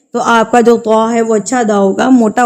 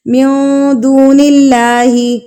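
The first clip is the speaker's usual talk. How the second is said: slow, drawn-out tajweed chant; slow melodic Quran recitation for students; much slower than usual. Shown as a demonstration. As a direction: recite each syllable slowly and melodically.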